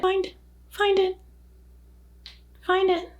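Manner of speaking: high playful coaxing; high squeaky voice. Pretend.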